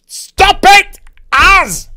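high, very loud real yell; shouted scolding. Real, not acted. Speaker interrupted mid-review by Az, shouted scolding. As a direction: snap out a sharp, loud telling-off. Scolding Az after quiet aside.

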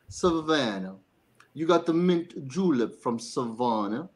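a hammed-up Southern drawl for the interviewer. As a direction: drawl it out like a Savannah local sipping a julep. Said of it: "Give me a Southern drawl".

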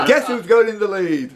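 high, gleeful laugh around the question; laughing announcement. Real, not acted. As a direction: giggle while announcing the new leader. Host laughs announcing the new leader.

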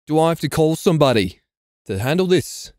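normal talking voice

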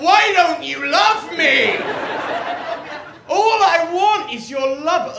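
high, shouted mock-desperate begging; desperate wail. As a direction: wail it at the crowd, drawing the words out. Desperate plea to the audience.

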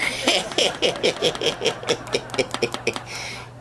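high breathy laugh; breathy cackle. Real, not acted. Comic laughing at his own doctor joke, breathy cackle. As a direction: crack up at the punchline.

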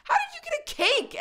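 high amazed squeal with a laugh; surprised squeal. Real, not acted. To her dog Addison, a high surprised squeal.

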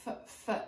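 f, f breathed voiceless and soft; sounding out f. Shown as a demonstration. Teacher sounding out the letter F for kids. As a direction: hiss the f sound out. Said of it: "You see the F".

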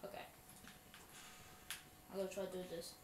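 ordinary talk, nothing marked

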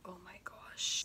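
embarrassed whisper. Real, not acted. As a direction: whisper it in disbelief. Cringing at childhood story.

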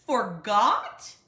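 father outraged at his cubs, full roar. A narrator's reading. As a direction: let the second Forgot? explode. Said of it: "roared Papa".

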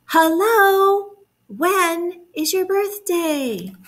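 high, slow, sing-out call; calling like Bear. Shown as a demonstration. Teacher has children join bear's call, calling out. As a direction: lead children in a drawn-out call. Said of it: "You say it with me".

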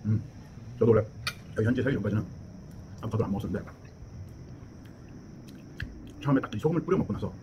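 talking with mouth full; muffled through chewed food; chewing while talking. Real, not acted. Seen 3: Spoonful eaten at -2; lips pursed, chewing through frames 0.3–8.4.

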